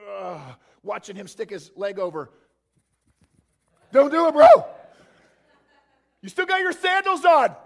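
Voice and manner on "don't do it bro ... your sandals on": much higher, much louder frantic warning; a fisherman yelling at his buddy from the boat; a disciple shouting at Peter not to step out; panicked disciple. Acted.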